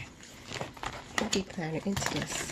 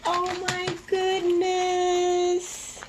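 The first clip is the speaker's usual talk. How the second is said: high, long-drawn squeal of delight; overjoyed; 11 semitones higher, 4 dB louder, much slower than usual. Real, not acted. Vlogger seeing her gift for the first time, overjoyed. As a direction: stretch it out high in real awe.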